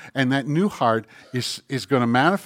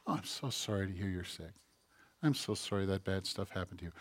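hushed, much softer pity voice; mock sympathy; 7 semitones lower, 15 dB quieter than usual. Comforting a friend with troubles, in a hushed mock-pity voice. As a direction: soothe them in a soft, put-on hush. Comforting someone with serious problems.

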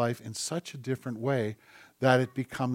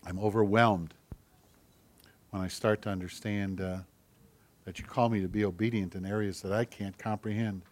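lower, softer prayer voice; 5 semitones lower, 5 dB quieter than usual. Real, not acted. Pastor leading the congregation in prayer, soft and low. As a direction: pray quietly, humbled before God. Pray with me.

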